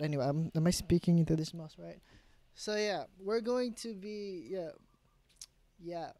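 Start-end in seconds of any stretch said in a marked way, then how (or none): none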